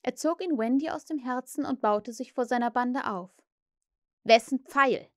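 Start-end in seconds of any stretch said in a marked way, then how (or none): 4.2-5.1 loud stern demand; strict, forceful question. A narrator's reading. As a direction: demand it severely. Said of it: "fragte er streng".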